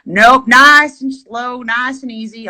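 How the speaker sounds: mock trail-guide voice; imitated guide. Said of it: "He's like".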